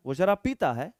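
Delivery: ordinary talk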